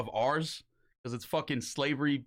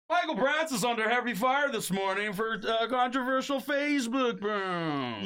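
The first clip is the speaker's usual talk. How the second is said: news anchor impression; 7 semitones higher than usual. Host mocking his own scandal as a news anchor. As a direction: announce it like a TV anchor breaking a story. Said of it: "my impression's pretty good".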